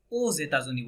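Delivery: aux États-Unis run together as "oh-zay-ta-zu-nee"; liaison pronunciation. Shown as a demonstration. Teacher modelling "going to the United States", aux États-Unis linked with a z. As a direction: sound the x of aux as z, glued onto États-Unis. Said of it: "এক্সটা ওই এ তার সাথে লেগে যাবে".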